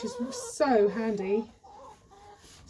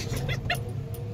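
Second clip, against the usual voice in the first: wild rambling cackles; 4 dB quieter than usual. Real, not acted. Laughing on bumpy ride.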